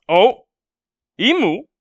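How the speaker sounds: high loud startled question; shocked surprise. Real, not acted. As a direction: blurt it out in shock. Imu suddenly appears.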